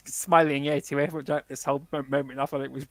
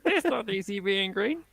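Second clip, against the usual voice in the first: high puppet-frog voice, much higher; Kermit impression; 7 semitones higher than usual. Co-host does a Kermit voice to prove a point. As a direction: squeeze it up into a cartoon frog. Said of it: "[name] do a Kermit impression".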